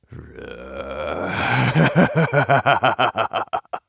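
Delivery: unprocessed evil cackle; slow sinister laugh with no effects; raw demon laugh. Shown as a demonstration. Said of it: "here's what it sounds like".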